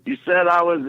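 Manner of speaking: high, shouted heckle from the back; mock yell. Cosby heckling the announcer from the back, high mock yell. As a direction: yell it up at the stage in mock protest. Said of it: "he's yelling this in the audience".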